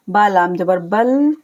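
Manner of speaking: usual speaking voice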